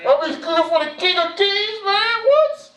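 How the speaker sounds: hammed-up shock at the news; mock disbelief. Pastor acts out his own shock at cooking for the King, in hammed-up disbelief.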